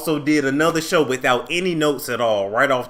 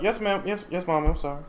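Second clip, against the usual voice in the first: meek apology; 8 dB quieter, much faster than usual. Retold or imitated. Trina replying meekly to mama.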